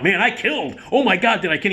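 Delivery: high-pitched, thrilled recap of a triumph; excited boasting. Retold or imitated. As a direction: imitate an actor gushing about killing it. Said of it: "He was so excited about it".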